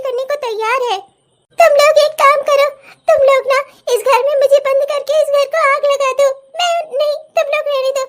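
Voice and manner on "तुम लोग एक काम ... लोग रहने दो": bitter outburst. Acted. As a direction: lash out at both daughters. Mother erupts at daughters. (गुस्से से चिल्लाती माँ)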